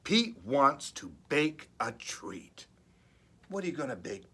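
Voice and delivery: ordinary delivery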